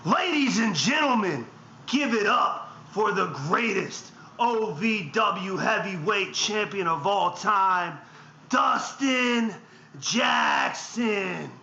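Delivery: slow, self-hyping ring intro; wrestling promo. Acted. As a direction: announce yourself like a champion.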